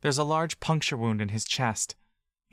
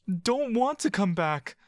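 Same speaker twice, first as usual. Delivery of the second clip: much higher, slow defiant refusal; a child refusing to go back home; defiant boy; 9 semitones higher than usual. Acted.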